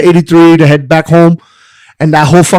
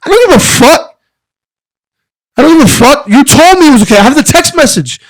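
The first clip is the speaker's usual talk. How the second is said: raised, higher-pitched exasperated protest; heated protest; 7 semitones higher than usual. Retold or imitated. To the boss on the phone, exasperated and raised. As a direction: push back hard, voice climbing in disbelief.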